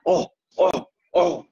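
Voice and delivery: high strained oh oh groans; pained groans. Retold or imitated. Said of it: "exclamation noises".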